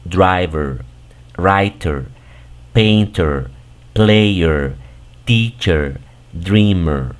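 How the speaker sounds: driver, writer, painter, player, teacher, dreamer, each alone with a falling schwa-R ending; model pronunciation. Shown as a demonstration. Teacher tells learners how to say the suffixed words, careful demo of driver, writer, painter with er pronounced. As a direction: keep the first vowel clear in driver and writer, then add er. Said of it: "aquí tienes que decir".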